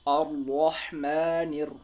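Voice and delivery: "अर रहमानिर" slow, with a full heavy r; slow recitation. Shown as a demonstration. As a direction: stretch it out slowly, a thick r. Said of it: "मोटा पढ़ेंगे".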